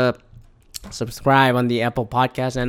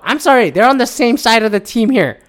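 higher, louder exasperated objection; 8 semitones higher, 5 dB louder than usual. Retold or imitated. Podcaster objecting to protesters on the same side, exasperated. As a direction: protest as if pointing out the obvious.

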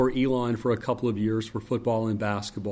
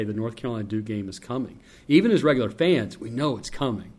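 ordinary talk

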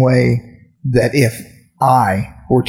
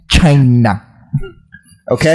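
Trump impression. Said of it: "let me quote Donald Trump".

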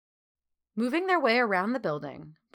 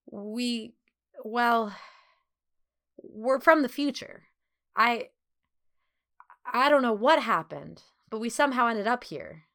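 halting, higher-pitched false starts; stammering; 5 semitones higher, 4 dB louder than usual. A narrator's reading. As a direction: stumble through an explanation, pausing after each start. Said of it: "he stammered".

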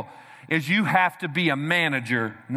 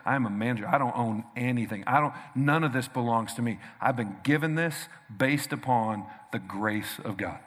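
much softer and lower, unhurried; humble self-reminder; 6 semitones lower, 6 dB quieter than usual. Pretend. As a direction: say it to yourself in humility. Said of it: "You must remind yourself every day".

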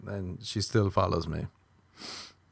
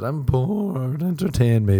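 put-on whiny bored girl; 4 semitones higher, 8 dB louder, slower than usual. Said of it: "If you said".

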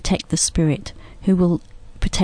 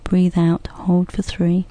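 guided breathing; 3 dB quieter than usual. Shown as a demonstration. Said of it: "So you can just follow along with us".

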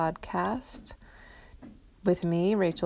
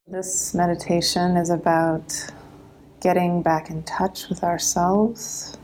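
meditation voice; 7 dB louder than usual. Acted. Host opens a live meditation for listeners, calm meditation voice. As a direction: ease them into the practice gently.

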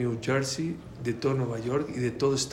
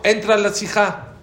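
louder and higher, insistent; a firm command to come in; rabbi's firm order; 6 semitones higher, 6 dB louder than usual. Retold or imitated. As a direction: tell her firmly to go in. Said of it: "Pero me dijo".

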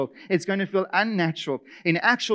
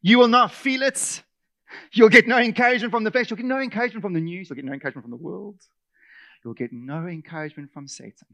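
preaching; 5 dB louder, faster than usual. Acted. Preaching list.

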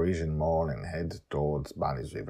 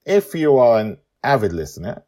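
plain reading voice

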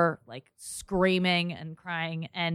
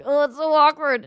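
high, loud mock cringe; 9 semitones higher, 6 dB louder than usual. Two teen girls mocking the awkward drive, high and loud. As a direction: squeal it like a giggling teen. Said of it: "So we're just like".

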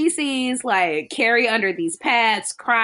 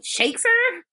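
usual speaking voice

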